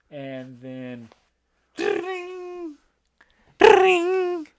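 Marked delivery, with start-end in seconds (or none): 1.8-2.8 high trilled ring sound; high-pitched mimicked ringtone; imitated phone ring. 3.6-4.5 loud, high trilled ring; piercing mimicked ringtone; imitated phone ring.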